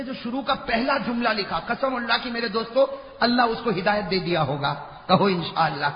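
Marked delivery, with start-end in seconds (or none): none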